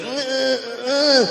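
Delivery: high short grunts; grunting noise. Retold or imitated. Imitating the Walmart lady grunting, high grunts. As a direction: do her grunt again. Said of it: "She's literally making that noise".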